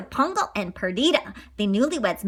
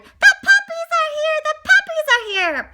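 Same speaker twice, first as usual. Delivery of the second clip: high, loud excited shout; Nanny shouts the puppies are here; 6 dB louder than usual. Acted. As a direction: call the news out to the house. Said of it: "the nanny shouted".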